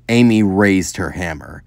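plain reading voice

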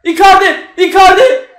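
high, urgent name calls; shouting to players. Real, not acted. Fan calling Barış, Osimhen and Icardi on, urgent shouts. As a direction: call out loudly across the pitch.

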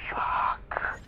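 whispered under breath; whispered curse. Real, not acted. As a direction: hiss it to yourself. Frustrated while lockpicking.

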